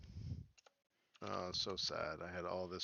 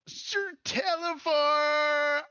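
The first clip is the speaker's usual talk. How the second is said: very high, loud goblin squeal; slow, shrieking small goblin greeting; Jingle Jangle, overjoyed; 7 dB louder, much slower than usual. Acted. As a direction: stretch out an ecstatic squeaky greeting. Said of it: "and he says".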